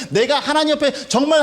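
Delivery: usual speaking voice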